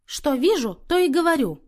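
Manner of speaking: high, emphatic huffy retort; sullen defiance. A narrator's reading. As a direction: answer back in a huff. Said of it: "насупилась та".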